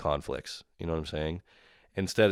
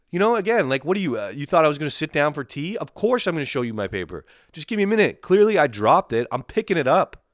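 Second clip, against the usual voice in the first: high, loud, exasperated protest; worked-up protest, pitch jumping; indignant; 7 semitones higher, 6 dB louder than usual. Acted. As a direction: argue back, outraged at the obvious. Indignant imagined answer to agent.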